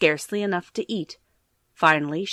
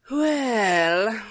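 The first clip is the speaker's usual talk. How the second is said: serious cat; 4 semitones higher than usual. Acted. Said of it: "very seriously answered the cat".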